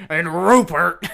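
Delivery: gravelly. Retold or imitated. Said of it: "You gotta get really gravelly there".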